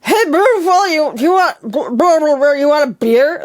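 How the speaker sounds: lightly voiced dumb bro hitting on someone; mock clueless pickup line, high; a hint of a dopey guy at a bar; dumb dude pickup. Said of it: "dumb dudes who go up to you".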